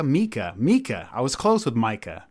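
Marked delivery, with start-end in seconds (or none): 0.0-1.1 Mika said MEE-kah; repeating the right name; right pronunciation. Shown as a demonstration. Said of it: "not Micah".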